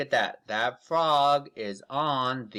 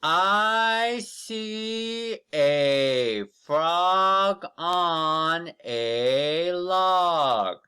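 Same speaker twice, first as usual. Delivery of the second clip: very slow, high and loud, each word stretched; drawn-out teacher read-aloud, louder and higher; slow model reading; 4 semitones higher, 4 dB louder, much slower than usual. Shown as a demonstration. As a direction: read it out slowly for children to copy. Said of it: "Read it with me".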